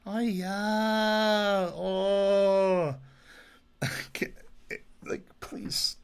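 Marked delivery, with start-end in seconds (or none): 0.1-2.9 a loud, high comic exclamation in a put-on character voice; Uncle Roger impression. Gushing about a comedy cooking channel, a loud mock Uncle Roger cry. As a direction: bellow Fui-o like a comedian mocking bad cooking. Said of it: "favorite cooking channels is Uncle Roger".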